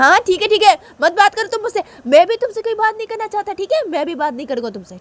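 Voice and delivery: high, indignant snap back; quarrelsome retort. Acted. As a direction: throw the refusal back at her. Sanju quarrels back at Uma.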